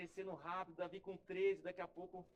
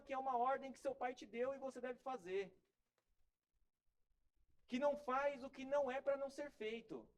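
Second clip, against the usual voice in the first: higher, building sermon delivery; rising preaching; 5 semitones higher than usual. Real, not acted. Pastor exhorts children to obey God, building preaching. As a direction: drive the point home to the congregation.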